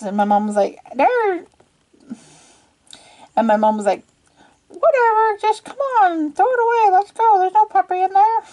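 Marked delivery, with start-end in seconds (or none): 1.0-1.5 short, high mock-mom reply; a squeaky cartoon mom pointing; silly mom voice. Mom answering her daughter in the same high silly voice. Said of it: "Why am I giving her that voice?". 4.7-8.4 high, dismissive, hammed-up mom; terrible mom voice. Mom dismissing the puppy, in a high, terrible, impatient voice. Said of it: "Why am I giving her that voice? That's a terrible voice".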